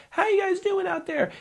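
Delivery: high, hopeful call to the crowd; stage greeting. Retold or imitated.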